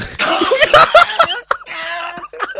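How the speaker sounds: long, loud, high-pitched burst of laughter. Real, not acted. Guest reminded of the Bloods gang story, laughing loudly.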